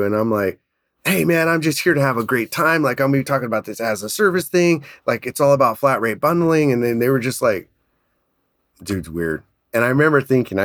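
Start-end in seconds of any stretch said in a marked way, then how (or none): 1.0-6.7 retold high-energy greeting, pitched up. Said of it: "Why is this guy so high energy". 8.8-9.4 low, soft mock aside; super quiet. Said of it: "they're being super quiet".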